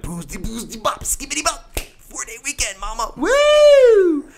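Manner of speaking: hoch gesungene Rockstar-Scats, am Ende ein lauter Juchzer; [name] impression. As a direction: ham it up like an eighties rock frontman, ending in a whoop. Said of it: "[name] obsessed".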